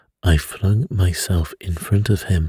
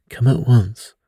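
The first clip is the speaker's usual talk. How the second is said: higher, urgent call for the doctor; alarmed cry for the doctor; cried out; 5 semitones higher than usual. A narrator's reading. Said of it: "I cried".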